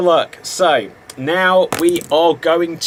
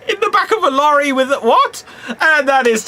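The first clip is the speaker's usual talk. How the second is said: laughing; 11 semitones higher than usual. Real, not acted. Host cracks up mid-line.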